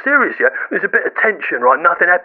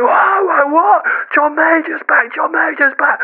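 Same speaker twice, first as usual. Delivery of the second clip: high-pitched, amazed fans; high mock-astonished cheering; excited crowd; 8 semitones higher, slower than usual. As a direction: imitate a crowd erupting at a surprise return. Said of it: "people are going".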